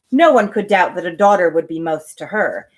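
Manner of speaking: ordinary delivery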